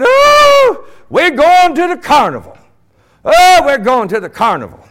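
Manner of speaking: high, loud squeal of delight; hammed-up, shrieking childlike glee; excited kids. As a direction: whoop like kids bursting with excitement. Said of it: "They were excited".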